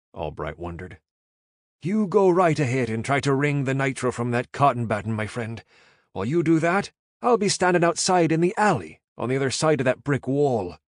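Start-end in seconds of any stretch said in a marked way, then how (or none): 1.8-10.8 louder, higher Irish brogue, dry sarcasm; sarcastic Irish. A narrator's reading. Caleb sarcastic.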